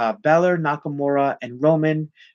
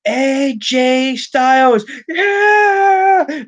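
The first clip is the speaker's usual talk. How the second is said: much higher, building to a shouted yeah; excited shout; 11 semitones higher, 5 dB louder, much slower than usual. Retold or imitated. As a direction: call out the name, then yell with joy. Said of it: "And he goes".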